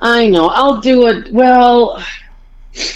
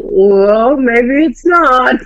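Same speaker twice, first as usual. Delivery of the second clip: slow, drawn-out imitation of mom; teasing, lingering concession; mock conceding; 5 semitones higher, much slower than usual. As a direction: stretch it out in a put-on doubtful voice. Imitates mom conceding after laughing.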